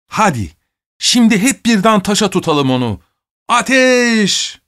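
high command building to a shout; rally the gang, peaking on the last word; rallying battle cry. A narrator's reading. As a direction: boyish firing order, pitched high. Boy orders a volley of stones.

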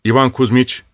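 usual speaking voice

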